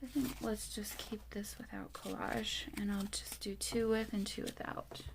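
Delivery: soft whisper, deciding aloud to herself. Real, not acted. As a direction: say it under your breath while working. Crafter deciding under her breath.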